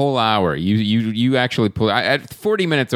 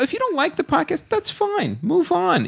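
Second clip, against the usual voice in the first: pitched way up, brushing critics off; high mock dismissive. Real, not acted. Host mocking bad reviewers in a high brush-off voice. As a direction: shrug them off in a high mock voice.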